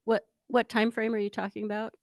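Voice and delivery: ordinary delivery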